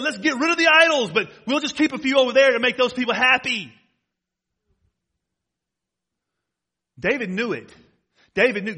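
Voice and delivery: loud, driving preacher's cadence, pressing every phrase; full-force preaching. Acted. Pastor warning the congregation about sin, booming preaching.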